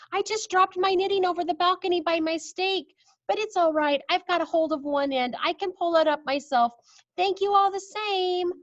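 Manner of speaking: put-on high, calm old lady voice; cool grandmother. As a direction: stay cool and polite. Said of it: "the coolness of her voice".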